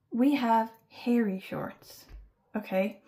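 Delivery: ordinary talk, nothing marked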